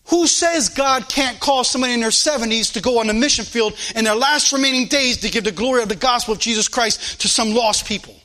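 normal talking voice

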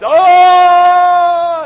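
sudden high, loud mourning wail. Shown as a demonstration. Preacher shows the mourners' loud wailing cry. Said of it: "crying out with a loud voice".

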